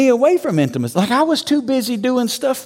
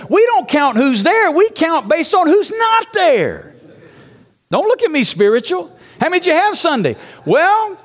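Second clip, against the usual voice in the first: preaching at full force; 8 semitones higher than usual. Acted. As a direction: rouse the room like a revival preacher. Fired-up preaching to church planners.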